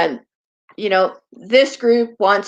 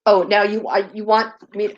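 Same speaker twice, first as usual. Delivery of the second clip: ordinary talk, nothing marked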